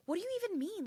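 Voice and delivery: put-on high, whiny kid pushing back; mock the seven-year-old's attitude; bratty kid voice. Mom imitating her son's snotty reply. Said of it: "the way he said it was like".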